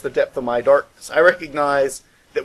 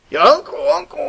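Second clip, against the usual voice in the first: much higher, wailing imitation of a beaten boy; mimicked crying kid; 12 semitones higher, 3 dB louder than usual. Bully crying for uncle while being beaten, high mock wail. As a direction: whimper it up high like the loser of the fight.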